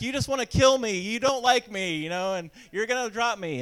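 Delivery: boy in the tree accusing his dad, half-acted tearful wail; mock bawling. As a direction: wail it like a panicked crying kid. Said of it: "I actually bawled my eyes out".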